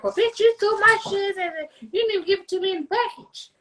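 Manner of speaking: high, fast sassy complaint voice; mock customer complaint. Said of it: "'cause I want you to be like".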